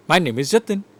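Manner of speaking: "Jatin" swung upward like a question; statement turned question, "Jatin" going up; questioning rise. Shown as a demonstration. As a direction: leave it open, rising on "Jatin". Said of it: "you might be surprised".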